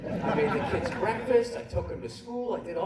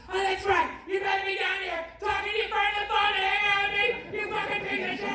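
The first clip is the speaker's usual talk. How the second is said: high-pitched screechy mock yell; mock furious wife, pitched way up; yelling wife impression; 14 semitones higher, 4 dB louder than usual. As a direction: screech it like an angry wife through a door. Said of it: "She starts yelling at me".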